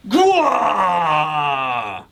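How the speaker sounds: long drawn-out mock monster roar; imitated scare cry; scary roar. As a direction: roar to scare someone, letting it trail down. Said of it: "tries to scare whoever walks up".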